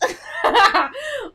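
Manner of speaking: loud high delighted laugh; high burst of laughter. Real, not acted. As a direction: let out a bright laugh. Delighted at excited partner.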